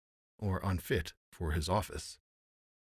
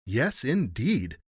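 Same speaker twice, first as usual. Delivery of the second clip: slow, raised, lilting assent; emphatic agreement; 5 semitones higher, 6 dB louder, slower than usual. A narrator's reading. Courtiers agreeing with the rogues, emphatic. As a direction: agree heartily, stretching each word. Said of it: "said all the courtiers".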